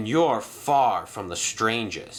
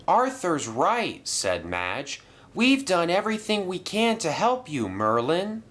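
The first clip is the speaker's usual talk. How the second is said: sympathetic Madge; 8 semitones higher than usual. Acted. Madge agreeing with Arthur to Merlin, higher and kind. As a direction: soften the blow.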